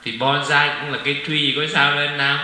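an uncle's cheerful comeback to Nam; excited retort. Retold or imitated. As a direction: toss back "Thì bonsai cũng là cây tree" brightly and loud. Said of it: "rồi bắt đầu cậu nói sao?".

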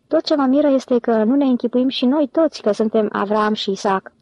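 ordinary delivery, nothing marked

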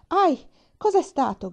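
sudden high, loud yelp of pain; pained cry. Acted. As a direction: cry out as the sting hits. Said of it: "gridò".

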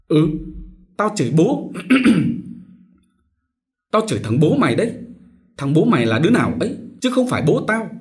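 high, loud, fast insults; cursing quarrel. A narrator's reading. Son Mão curses his brother in a loud rapid quarrel. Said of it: "chửi nhau".

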